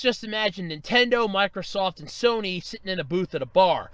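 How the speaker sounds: ordinary talk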